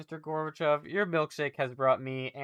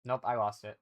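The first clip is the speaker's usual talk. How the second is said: normal talking voice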